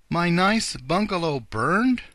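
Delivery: high, loud, slow exclamation; dismayed exclamation. A narrator's reading. Rabbit gentleman learning his bungalow burned, dismayed exclamation. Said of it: "exclaimed the rabbit gentleman".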